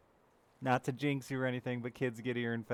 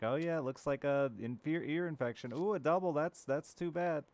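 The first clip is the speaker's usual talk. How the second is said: higher, sing-song doctor voice, a bit slowed at the end; mock breezy doctor; 6 semitones higher, 4 dB louder than usual. Parent imitating the doctor's chirpy diagnosis, higher and sing-song. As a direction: play the casual doctor lightly. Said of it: "they're like".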